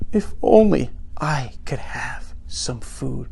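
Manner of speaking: slow, soft, low wistful plea; lightly acted sadness, drawn out quietly; mock tearful longing. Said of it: "Alien starts crying".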